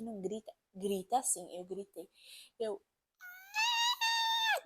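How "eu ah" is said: high piercing scream of pain; labour scream. Retold or imitated. Mother recalls screaming while giving birth, sharp high scream. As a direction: let out a raw birthing scream. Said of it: "gritei lá".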